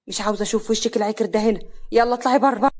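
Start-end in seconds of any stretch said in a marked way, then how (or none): 1.9-2.7 loud, harsh order to leave. Acted. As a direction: bark her out of the house. Husband orders wife out.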